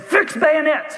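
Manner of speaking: high yelled command; yelled order. Acted. Chamberlain yells to his men, high command. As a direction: bark the order down the line. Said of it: "And he yelled it".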